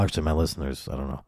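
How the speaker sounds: normal talking voice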